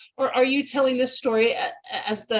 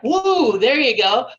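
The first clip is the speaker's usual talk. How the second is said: excited cheer; 2 semitones higher, 6 dB louder, slower than usual. Acted. Cheering her own creative idea.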